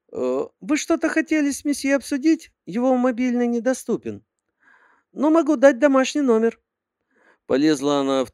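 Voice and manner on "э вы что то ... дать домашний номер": put-on high girly secretary voice; sweet, high-pitched young secretary; cartoon princess. A narrator's reading.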